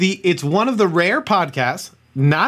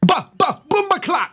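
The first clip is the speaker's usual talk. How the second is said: put-on Caribbean accent; 12 semitones higher than usual. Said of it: "Can you do a Trinidad, like, accent".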